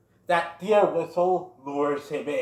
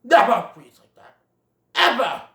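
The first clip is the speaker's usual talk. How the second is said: louder, higher stern lecture; stern scolding father; 4 semitones higher, 7 dB louder than usual. Retold or imitated. Great Prince scolding Bambi, stern. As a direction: scold him hard. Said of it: "changes it to".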